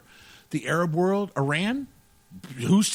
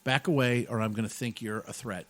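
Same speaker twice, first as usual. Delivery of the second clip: mild warning; 6 semitones lower, 7 dB quieter than usual. Retold or imitated. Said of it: "And Joe's like".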